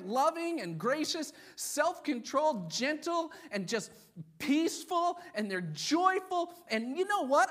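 high, loud, excitable mock-Irish voice; half-Irish accent. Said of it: "imagining somebody with half an Irish accent".

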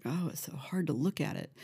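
hushed whisper, breath only; quiet whispered aside. Retold or imitated. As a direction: whisper it like a confession. Whispered quote, unvoiced.

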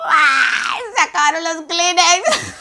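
high, loud laughing wail; hammed-up weepy shout; mock sobbing cry. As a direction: cry out through fake tears. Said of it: "no, yo".

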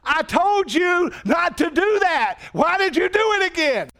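the law thundering at your heart; the law's accusing voice. Retold or imitated. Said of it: "the law comes to your heart and says".

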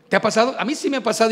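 normal talking voice

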